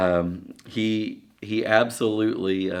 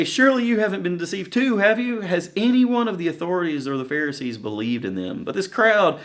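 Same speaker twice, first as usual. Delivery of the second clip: higher, scornful mock lecture; much higher huffy scolding; indignant Pharisees; 10 semitones higher, 3 dB louder than usual. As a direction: play up the snooty Pharisee. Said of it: "They say".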